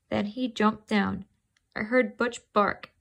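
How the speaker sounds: usual speaking voice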